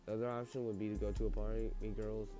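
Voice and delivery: ordinary delivery, nothing marked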